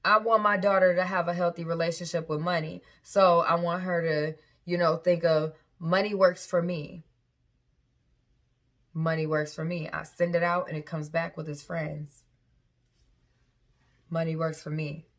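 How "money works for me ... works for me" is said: calm affirmation. Shown as a demonstration. Affirmations for her daughter.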